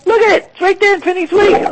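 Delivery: high, fast, thrilled pointing out; excited. Real, not acted. Host showing the listeners the page, thrilled and high. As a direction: gush over the discovery.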